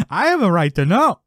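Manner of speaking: high, loud mock indignation; hammed-up dad insisting at full voice; yelling dad.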